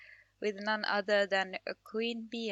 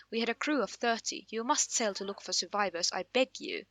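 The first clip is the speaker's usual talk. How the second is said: fast, louder, panicked survivor; frantic half-drowned sailor; 3 semitones higher, 4 dB louder, faster than usual. Acted. Half-drowned sailor begging the queen for help, frantic and fast. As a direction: blurt it out, just pulled from the waves.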